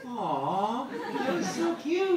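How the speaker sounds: very high, drawn-out coo; squeaky, loud gush; cooing delight. Retold or imitated. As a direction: melt over something adorable. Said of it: "I'm like".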